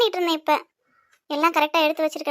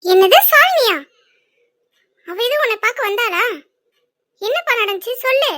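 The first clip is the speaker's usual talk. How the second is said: high-pitched, cross questioning of her son; acted maternal anger; angry mother; 5 semitones higher, 4 dB louder than usual. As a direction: demand answers, voice jumping up in annoyance. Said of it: "ஏம்மா தேவையில்லாம கோவப்படுற".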